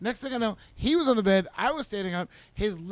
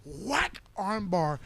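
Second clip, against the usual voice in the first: ordinary talk, nothing marked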